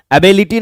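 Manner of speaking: एबिलिटी said wrongly as "a-BAY-li-ti"; mispronunciation. Shown as a demonstration. English teacher shows students the wrong form, mispronounced. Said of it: "एबिलिटी नहीं".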